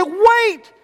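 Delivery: indignant shock. Retold or imitated. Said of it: "And you say".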